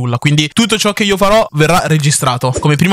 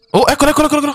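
excited; 10 semitones higher, much faster than usual. Real, not acted. Spots the target in game.

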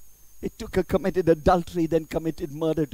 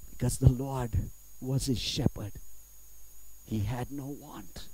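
hushed reverence; 5 semitones lower, 9 dB quieter than usual. Acted. Telling how David worshipped, hushed reverence. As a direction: drop to a reverent hush, phrase by phrase.

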